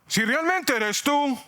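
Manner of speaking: high, slow, pleading call; Peter calling out. Acted. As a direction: call out across the water, unsure. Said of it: "Pedro llamó".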